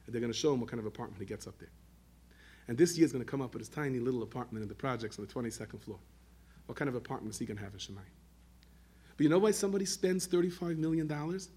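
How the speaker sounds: ordinary delivery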